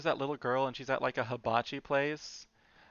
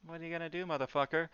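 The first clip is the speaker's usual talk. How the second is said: mock menacing taunt; 5 semitones higher, faster than usual. Shown as a demonstration. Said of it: "so it's gonna be the left brow going in".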